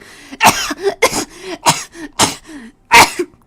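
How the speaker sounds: loud rasping choking fit; choking cough. Acted. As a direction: choke and cough loudly. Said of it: "Grandma was choking loud enough".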